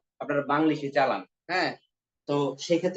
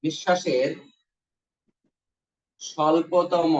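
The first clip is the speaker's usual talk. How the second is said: very slow dictation with long pauses; measured, drawn-out chunks, pausing after each; 2 semitones lower, slower than usual. Shown as a demonstration. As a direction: dictate word by word for note-taking. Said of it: "লিখবেন".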